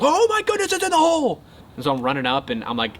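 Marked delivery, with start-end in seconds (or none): 0.0-1.4 much higher, louder thrilled yell; high loud joyful cry; excited shout. Retold or imitated. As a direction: yell it like you just saw the ball drop. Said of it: "I had a millisecond to react".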